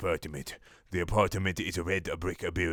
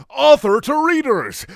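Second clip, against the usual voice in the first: announcer voice; 12 dB louder than usual. Acted. Mock author announcement.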